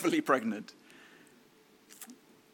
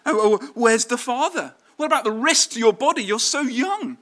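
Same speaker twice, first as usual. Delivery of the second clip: higher, louder anxious fussing; worried relative; 8 semitones higher, 6 dB louder than usual. Retold or imitated. Relative fussing over young Mary, mock anxious. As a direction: fret like a worried aunt, fast. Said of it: "You'll be thinking".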